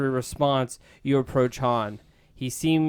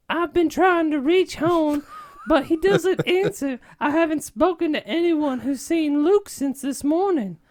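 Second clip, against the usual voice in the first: worried Leia; 4 dB louder than usual. Acted. Princess asks the hero about Luke, high worried voice. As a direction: lift the voice high and fret. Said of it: "Leia says with concerns".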